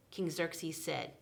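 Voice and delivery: usual speaking voice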